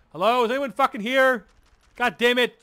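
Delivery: loud, high, frantic call for help; panicking shout. Retold or imitated. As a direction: re-enact yelling down an empty hospital floor. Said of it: "I'm kinda panicking".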